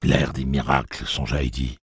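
ordinary delivery, nothing marked

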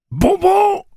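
very high, loud squeal; creature screech. A narrator's reading. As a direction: shriek the word like a parrot. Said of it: "s'écria le bafou bafouilleux".

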